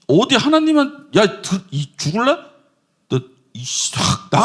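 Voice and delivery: a hammed-up angry outburst, half swallowed; mock angry rebuke. Preacher acting Eve scolding the serpent, mock angry. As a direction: scold the tempter, then bite back the curse. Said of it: "뭐라고 얘기했어야 됩니까?".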